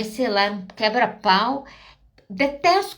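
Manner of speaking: usual speaking voice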